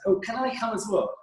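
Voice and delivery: high-pitched hopeful ask; eager plea. Retold or imitated. Junior assistant asking boss to join trip, eager high plea. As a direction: pipe up like a keen junior begging to come along.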